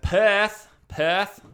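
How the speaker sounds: Perth punched high and loud, R barely there; Perth accent. Shown as a demonstration. Teaching how locals say Perth, broad Australian accent. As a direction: call out Perth with a subtle R. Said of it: "the R is very subtle".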